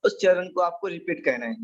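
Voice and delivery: ordinary talk, nothing marked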